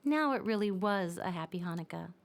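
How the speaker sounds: plain reading voice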